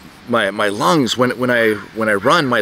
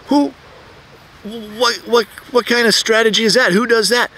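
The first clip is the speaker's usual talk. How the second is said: baffled disbelief; 10 semitones higher than usual. Retold or imitated. Baffled thought as runner passes.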